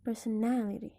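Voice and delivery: "personality" with the main stress on NA. Shown as a demonstration. Teacher showing stress moved by a suffix, NA stressed in "personality". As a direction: punch the NA in "personality". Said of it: "the stress moves from per".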